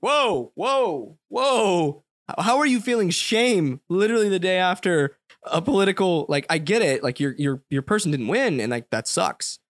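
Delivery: loud, high, exasperated disbelief; incredulous whoa. Retold or imitated. Telling off a buddy upset over election results, loud incredulous. Retelling his reaction to buddy.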